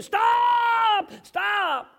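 long, high, full-voiced yell to halt a car; screaming. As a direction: imitate the wild man's high scream. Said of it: "he was screaming".

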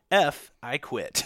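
texting his dad a joking surrender; mock defeat. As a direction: sigh it out like a sore loser. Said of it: "I go".

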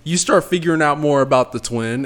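ordinary talk

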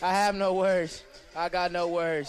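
breathless, thrilled and slow; awestruck excitement. Real, not acted. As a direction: still reeling from the goal. Stunned reaction after the goal.

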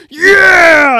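high, full-force whoop; cheering yell. Acted. Gang member cheers the plan, whooping yell. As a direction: yell it long and loud.

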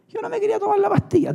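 quick high complaining voice; high mock protest. Preacher mocking his refusal of pills, fast high whine.

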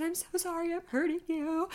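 high, soft pleading apology, acted; retold distressed apology. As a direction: apologize helplessly in a small high voice. Retold apology while squeezing nurse.

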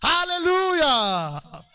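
long drawn-out high, loud hallelujah; shouted hallelujah. Real, not acted. Preacher to congregation, a slow shouted hallelujah. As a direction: stretch it out as a slow cry of praise.

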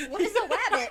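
high, loud, laughing bewilderment; baffled outburst. Retold or imitated. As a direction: burst out, utterly lost and amused. Laughing, baffled by friend's odd word.

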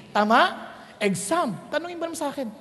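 ordinary talk

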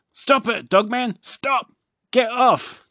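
high, loud shouting at the dog; chief yelling. A narrator's reading. Chief shouting at Dog Man licking him, high and loud. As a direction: yell stop at a jumping dog. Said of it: "says the chief".

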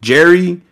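Jerry called out high and loud; the name Jerry. Shown as a demonstration. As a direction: say Jerry like the family calls him. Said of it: "They just".

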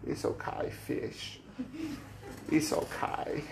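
put-on soft whispered toddler voice comforting a fish; breathy little-girl whisper, slow and soothing; imitating a little girl. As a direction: hush it tenderly like a small child hugging her catch. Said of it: "and she goes".

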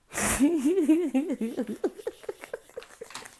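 loud, high laugh tailing off; derisive laugh. Real, not acted. Laughing at the couple's California dreaming, derisive laugh. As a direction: burst out laughing mid-story.

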